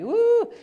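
sudden high whoop. Real, not acted. Preacher whooping about his daughter, high whoop. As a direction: let out a high woo.

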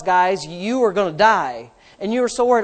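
ordinary talk, nothing marked

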